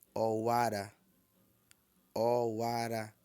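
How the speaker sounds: "Owara" drawn out low and level; all low tone. Shown as a demonstration. Teacher repeats October for learners, all low tones. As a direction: keep every syllable of "Owara" low. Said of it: "Tudo em tom baixo".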